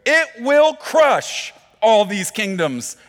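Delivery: fervent scripture reading. Acted. Preacher reading Daniel to the church, loud and fervent. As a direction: read the verse like a proclamation.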